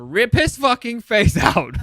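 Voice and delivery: loud, laughing gusto; amused gusto. Real, not acted. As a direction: punch it out loud, breaking into laughter. Relishing a gory kill, laughing.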